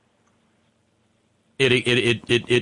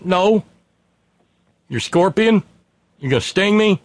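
voiced higher as a nervous frog, pleading; frightened frog; 7 semitones higher than usual. Retelling the fable to the host, a higher, worried frog voice. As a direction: play the frog, alarmed and protesting.

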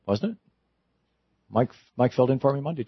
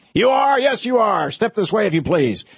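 mock-stern Scrooge; 10 semitones higher than usual. Scrooge pretending fury at late Bob, hammed-up shout. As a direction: bark at the clerk like a furious old boss.

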